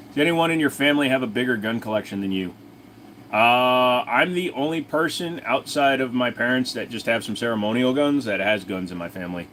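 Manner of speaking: ordinary delivery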